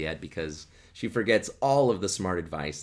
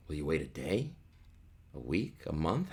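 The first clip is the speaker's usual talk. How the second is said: teasing test; 6 semitones lower, 8 dB quieter than usual. Retold or imitated. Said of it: "putting him to the test and saying".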